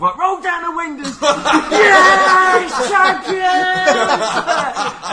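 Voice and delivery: high, very loud cry of victory; jubilant shout. Retold or imitated. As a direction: yell it out the car window. Dad's celebration shout retold.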